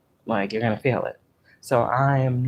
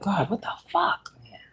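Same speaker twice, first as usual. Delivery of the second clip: exasperated at God; 7 semitones higher, 3 dB quieter than usual. Retold or imitated. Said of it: "I'll be like".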